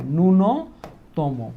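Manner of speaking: very slow, spelling ন্যূনতম out syllable by syllable; teacher slowly dictating a spelling; slow dictation. Shown as a demonstration. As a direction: drag out each sound so the class catches it. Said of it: "জফলাটা এই জায়গা হবে".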